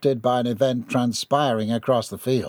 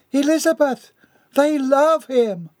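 amazed; 13 semitones higher, 4 dB louder than usual. A narrator's reading. Missus Dixon amazed.

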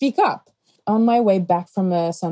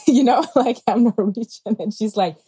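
normal talking voice

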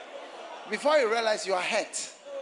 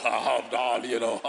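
much lower crooning darling; bass-voiced suitor; 8 semitones lower, 3 dB louder than usual. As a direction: drop into a deep lover's croon. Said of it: "wanted a really bass voice".

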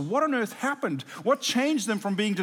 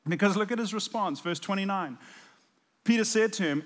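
ordinary talk

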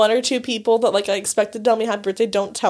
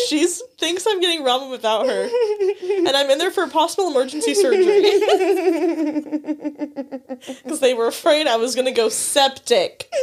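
laughing; 4 semitones higher than usual. Retold or imitated. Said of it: "I was like".

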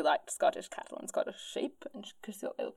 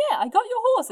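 high, louder mock quote; breezy imagined reply; 7 dB louder, faster than usual. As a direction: toss it out high and loud. Voicing Rosa's imagined reply.

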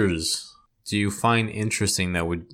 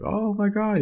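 much higher, gasping oh my God; imagined shocked reaction; 11 semitones higher than usual. Retold or imitated. Picturing the recipient opening the card, gasping in shock.

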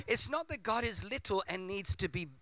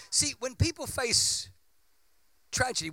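ordinary talk, nothing marked

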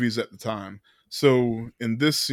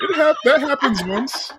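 high, laughing through words; 12 semitones higher, 5 dB louder than usual. Real, not acted. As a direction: laugh while you say it. Laughing at the quote.

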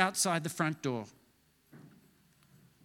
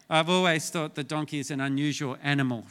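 ordinary talk, nothing marked

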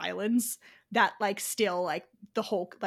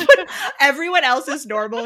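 laughing; 5 semitones higher, 10 dB louder than usual. Real, not acted. Laughing about sped-up actress.

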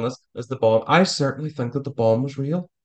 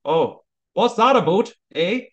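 higher, put-on Canadian accent with a stretched eh; mock Canadian accent; 6 semitones higher, 3 dB louder than usual. Host mocking the obvious Canadian accent for cohost, playful Canadian accent. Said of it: "they don't have the obvious".

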